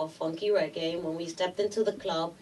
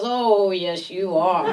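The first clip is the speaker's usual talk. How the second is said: imitated drawn-out, louder insistence; insistent director; 5 dB louder, slower than usual. Director telling her she is an actress, loudly insistent. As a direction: put on a teasing, overriding voice.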